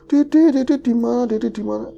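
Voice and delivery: put-on mother calling her baby, high and anxious. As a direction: call out high for the lost child.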